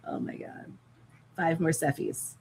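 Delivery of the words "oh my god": a soft whispered "Oh my God". Real, not acted. Catching her breath after the laugh, whispered.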